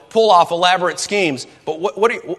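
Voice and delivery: ordinary delivery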